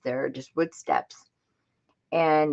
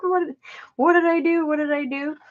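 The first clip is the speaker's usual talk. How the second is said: high panicked what did I do; 12 semitones higher than usual. Retold or imitated. Shocked after the fall.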